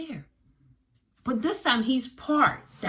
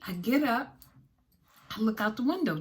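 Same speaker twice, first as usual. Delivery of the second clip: usual speaking voice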